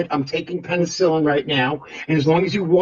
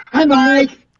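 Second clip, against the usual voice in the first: sing-song hi; 8 semitones higher, 6 dB louder than usual. Acted. Campy greeting into the mic.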